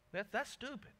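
lightly voiced Jesus shutting John down; Jesus rebuking. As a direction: brush him off in a put-on voice. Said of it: "Jesus looks at them and goes".

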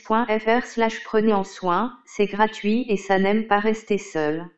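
robotic text-to-speech read; monotone computer voice reading aloud; old speech synthesizer. Acted. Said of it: "Vous avez sélectionné Microsoft Hortense Desktop".

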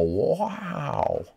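ordinary delivery

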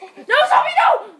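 very high, loud yelp; high-pitched alarm; panicked shout. Real, not acted. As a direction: yell in sudden panic at an attacker. Panics at attacking zombie.

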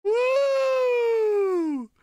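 very high, long "woo"; jubilant, held falsetto cheer; victory whoop. Real, not acted. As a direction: let out a sustained high-pitched whoop.

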